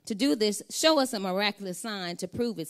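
lightly voiced leaders pressing Jesus for proof.

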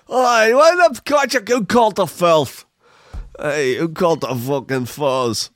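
high, indignant mock Scot; put-on Scottish outrage, opening high and loud; bad Scottish accent. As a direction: complain in a hammed-up Scottish accent. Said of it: "poor Scottish swan".

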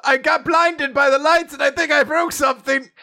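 high, whiny mock panic; hammed-up wounded villain crying for help; panicked villain. Hamming up the father's injury.

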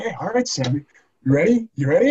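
high, eager coach with rising questions; grinning coach. Retold or imitated. Coach to player before his first play, grinning excitement. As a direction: imitate a coach asking through a big grin. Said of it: "huge shit-eating grin on his face".

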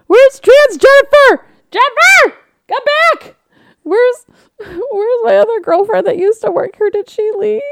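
very high, loud mock whine; high whiny plea. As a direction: whine like a lost customer. Pleading for old barista.

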